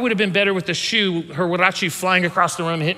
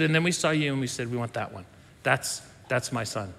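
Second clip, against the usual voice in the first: much softer and lower, loving; tender mom; 6 semitones lower, 7 dB quieter than usual. Retold or imitated. Mother telling son how they chose him, soft and lower. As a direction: hush it like a treasured memory. Mom retells choosing baby.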